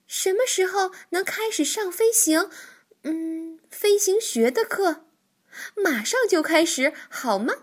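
high, impatient, eager asking; eager question. A narrator's reading. 跳跳迫不及待地问白鹳什么时候上课，急切兴奋. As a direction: beg to start right now. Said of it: "跳跳兴奋地拍着手问".

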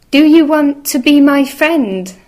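high, loud, quick little mouse. Acted. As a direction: call out fast like a tiny mouse. Mouse asks each animal.

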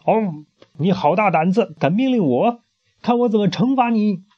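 high, indignant threat; queen threatens in fury; furious queen. Acted. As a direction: rage at being ordered. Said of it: "气坏了".

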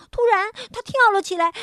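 ordinary delivery, nothing marked

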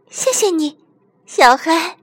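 high, small creature voice; grateful squeaky toy. Acted. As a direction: thank a friend in a tiny high voice. Squeaky toy thanks rescuer. (尖细的小玩具嗓音道谢)

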